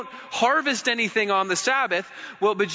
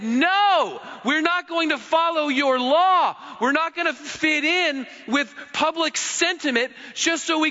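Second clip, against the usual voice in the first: defiant declaration; 5 semitones higher than usual. Retold or imitated. German believers refusing the Nazi law, defiant. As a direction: declare it like a rallying cry.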